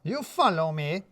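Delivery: put-on indignant, drawn-out foreign accent; accented Radu. A narrator's reading. Radu refuses the boss, slow accented voice.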